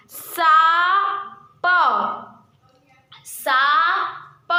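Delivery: साप stretched very slowly to let the nasal vowel ring; drawn-out demo word. Shown as a demonstration. Teacher to class, showing the nasal sound, drawn-out slow साप. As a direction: draw out साप slowly so pupils hear the vowel after सा. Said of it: "सा के बाद क्या pronounce हो रहा है?".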